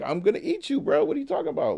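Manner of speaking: a hammed-up eagle teasing his prey; mocking eagle. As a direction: sneer it like a villain bird. Eagle turns on the frog.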